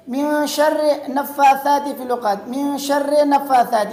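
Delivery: "شر" as "sharre", split from "نفاثات"; wrong joining. Shown as a demonstration. Teacher imitates friends' mistake, unjoined ra and nun. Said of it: "اکثر ساتھی کیا پڑھتے ہیں".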